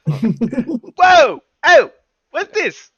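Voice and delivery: high, loud mock-panicked shout; Penguin shouting. Penguin grabbed by the collar in a high loud mock-panicked shout. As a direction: holler like a mobster in a panic. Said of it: "I would be like".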